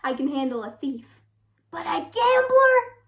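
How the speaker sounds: can't believe it, voice leaping up on "gambler"; wife's incredulous cry at her husband's gambling; outraged disbelief. Acted.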